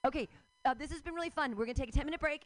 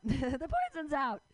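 laughing; 4 semitones higher than usual. Retold or imitated. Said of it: "and I'm like".

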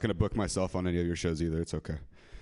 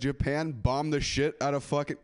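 usual speaking voice

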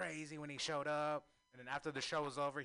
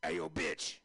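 low, rough rapper bark; 4 semitones lower than usual. Retold or imitated. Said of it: "This is my impression of, uh, D M X at the D M V".

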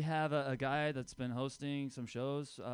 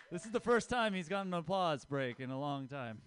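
ordinary talk, nothing marked

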